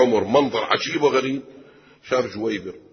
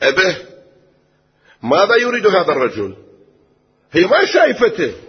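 put-on young daughter calling; 7 semitones higher, 7 dB louder than usual. Daughter calls to her father.

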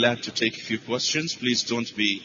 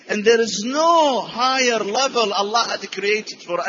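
high, louder consoling exhortation; exhorting Prophet; 12 semitones higher, 5 dB louder than usual. Retold or imitated. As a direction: lift her up with fervent assurance. Prophet consoles mother of Sa'd.

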